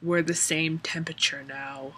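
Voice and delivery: whispered mock romantic line; imitating Edward in a hushed whisper. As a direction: breathe it like a swooning lover. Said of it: "he goes".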